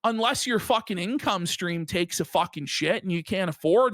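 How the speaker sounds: usual speaking voice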